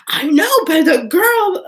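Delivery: stretched-out excited protest; excited whine. Retold or imitated. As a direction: drag it out like an excited excuse. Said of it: "I'm like".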